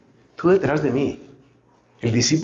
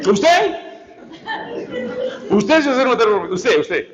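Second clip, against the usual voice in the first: incredulous mocking; 7 semitones higher than usual. Christ mocking Peter's promise with incredulous disbelief. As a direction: laugh off the boast in disbelief.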